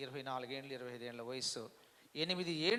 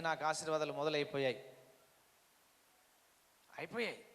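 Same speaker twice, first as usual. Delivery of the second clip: ordinary talk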